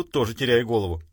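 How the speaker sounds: ordinary delivery, nothing marked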